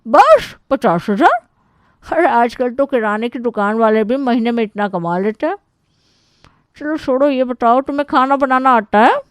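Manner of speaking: high, loud, unimpressed aunt voice; interrogating aunt. Acted. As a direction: scoff like a pushy aunt grilling the suitor. (तंज़ कसती बुआ की ऊँची आवाज़)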